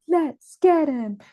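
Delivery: hammed-up mob voice; angry mob member. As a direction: draw it out like a mob's battle call. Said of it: "I was actually talking about the mob".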